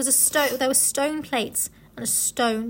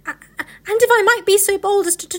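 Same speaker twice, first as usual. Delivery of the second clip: nervous stutter climbing higher and louder; timid, deferential fox tripping on words; stammering fox; 7 semitones higher, 5 dB louder, faster than usual. A narrator's reading. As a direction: stumble over first sounds, then rush the toast. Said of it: "stammered the fox".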